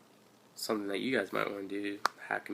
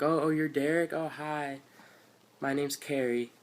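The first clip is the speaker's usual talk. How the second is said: mock cheerful greeting, much higher; cheerful recruiter; 6 semitones higher than usual. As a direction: play the bubbly recruiter saying hi. Voicing the girl recruiter greeting.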